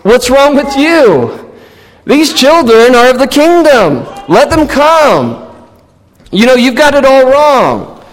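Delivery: lightly voiced Jesus rebuking the disciples; gentle rebuke. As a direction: correct them kindly but firmly. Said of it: "he rebuked them and he says".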